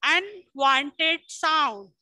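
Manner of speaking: each word drawn out slowly for the class; slow dictation pace. Shown as a demonstration. Teacher to class, dictating slowly. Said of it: "Loud and".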